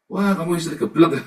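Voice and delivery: mocking laugh through the words; laughing scold. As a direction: tease the foolish wives. Mocking foolish wives, laughing. (ejekan sambil tertawa)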